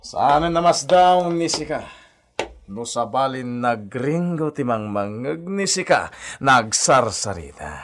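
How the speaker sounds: ordinary talk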